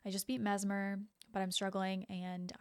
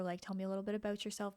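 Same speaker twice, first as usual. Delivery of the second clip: normal talking voice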